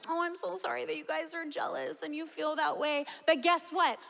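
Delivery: sweetly sarcastic Peter; mock-sympathetic sarcasm. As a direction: fake sorry, then a turn. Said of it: "And he's like".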